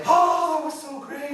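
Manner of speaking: high, loud "Oh" breaking into a laugh; delighted outburst. Retold or imitated. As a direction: gush with joy after the parade. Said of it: "they're just like".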